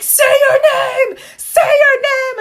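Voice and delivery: high, loud, slow mock shout with a cry in it; yelling nurses. Nurses yelling at her to speak, a high slow shout. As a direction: bellow it like the whole room yelling. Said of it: "everyone's yelling at me".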